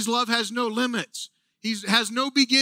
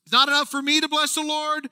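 higher and louder, insisting it's not enough; building excitement; 5 semitones higher, 3 dB louder than usual. Retold or imitated. Preacher voicing David wanting more praise, excited. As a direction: push harder with each line. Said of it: "And he goes".

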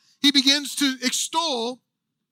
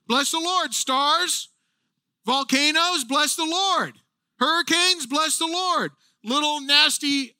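fired-up preaching; 6 semitones higher than usual. Acted. Preacher rallying all creation to praise, building to a shout. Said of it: "you want other people to get fired up".

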